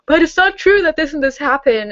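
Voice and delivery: put-on voice of an objecting comment; imitating a commenter. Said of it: "I will have comments saying".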